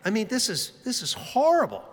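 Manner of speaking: mock-upset, pitch climbing on the complaint; retold distress. Husband seeking sympathy from his wife, distressed.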